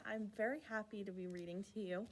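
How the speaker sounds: usual speaking voice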